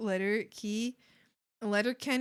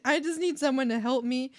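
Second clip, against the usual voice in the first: ordinary talk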